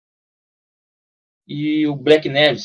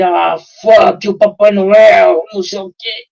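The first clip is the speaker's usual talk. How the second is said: angry slow curse; 4 semitones higher, 7 dB louder, slower than usual. Retold or imitated. Angry American quoted.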